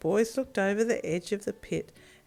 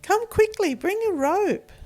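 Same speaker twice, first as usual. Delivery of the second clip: high, loud urgent shouting; crying out for help; 12 semitones higher, 5 dB louder than usual. Acted. Boy runs to his dad, shouting for help. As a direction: yell for help while running off.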